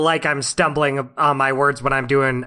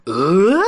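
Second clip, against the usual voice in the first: rising questioning "uh?" noise; imitated character grunt; 4 semitones higher, 4 dB louder than usual. Co-host demonstrating a signature noise, imitated rising grunt. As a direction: mimic a signature grunt, sliding upward. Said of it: "that's Heil's own".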